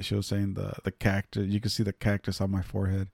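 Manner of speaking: usual speaking voice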